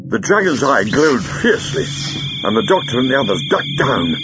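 usual speaking voice

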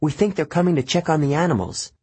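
nervous satyr whisper-worry; Grover's voice. A narrator's reading.

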